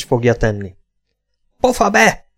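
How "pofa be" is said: high, abrupt cry to shut someone up; mild narrated yell, pitch jumping up; bellowed command. A narrator's reading. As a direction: cut her off with a high-pitched outburst. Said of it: "Ordította hát".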